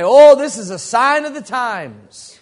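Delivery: preacher crying wolf over world events; alarmist preacher. Retold or imitated. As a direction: proclaim it with mock solemn urgency. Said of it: "and they'll say".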